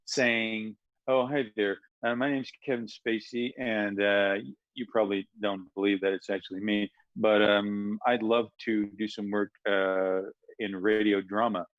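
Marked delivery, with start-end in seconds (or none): 1.1-11.7 smooth American actor on the phone; Kevin Spacey. Retold or imitated. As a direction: imitate a film star making a polite call. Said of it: "pretending to be Kevin Spacey".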